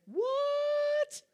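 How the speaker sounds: high, shocked "What?"; a squeaky startled "What?"; surprised God. Pretend.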